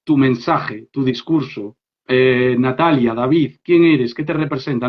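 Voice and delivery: normal talking voice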